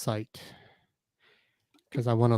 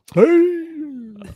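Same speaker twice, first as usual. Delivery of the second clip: high DJ shout-out; seventies DJ voice. Shown as a demonstration. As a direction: call it out like a retro DJ. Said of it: "when you do a seventies D J voice".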